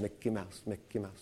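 very soft, low mocking mutter. Lady walking past the frustrated man, mocking him under her breath. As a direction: imitate a taunt murmured in passing, barely audible. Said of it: "she was mocking me".

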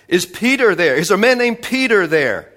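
men at the gate shouting up to the house; shouted from the gate. Retold or imitated. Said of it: "and they shouted".

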